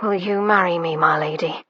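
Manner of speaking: out of breath and low; breathless. Acted. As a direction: gasp the question out. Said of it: "and gasped".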